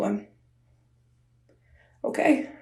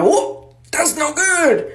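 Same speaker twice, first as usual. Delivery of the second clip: mildly alarmed, higher and drawn out; alarmed reaction; 7 semitones higher, much slower than usual. Retold or imitated. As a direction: stretch it out in higher-pitched alarm. Startled reaction in retold story.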